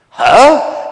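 high, loud yelp of disbelief; shocked, rising question; incredulous. Acted. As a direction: blurt it out in outraged astonishment. Tina disbelieves the king's verdict.

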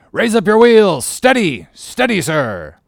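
high, loud commands, slowing on the last steady; shouted helm orders. Acted. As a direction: command the helm over the wind.

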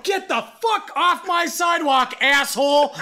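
high, loud, drawn-out mock shout; angry yell. Driver yells rider off his sidewalk, angry shout. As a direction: re-enact bellowing him off the sidewalk. Shouting back in retold argument.

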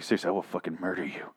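hushed threat with a knife at his throat; quick hushed warning; quiet threat. Retold or imitated. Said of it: "He's like".

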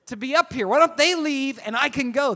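exasperated inner rant. Retold or imitated. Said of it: "I'm like".

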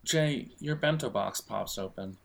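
normal talking voice

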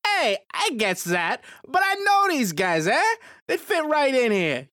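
lightly played Italian mobster accent; a hint of an Italian mobster accent. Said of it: "strong Italian accent".

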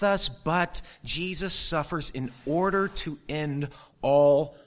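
high, loud preaching build; impassioned pulpit climax; preaching at full force. Acted. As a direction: drive the gospel home to the church.

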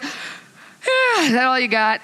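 high, mocking challenge; taunting. God answering the enemy's best attempt, amused taunt. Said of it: "God's like".

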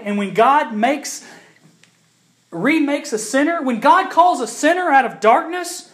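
loud, high pulpit proclamation; full-force preaching. Acted. To the congregation about creation, preached loud. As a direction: preach it out with conviction.

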